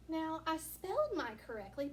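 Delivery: ordinary talk, nothing marked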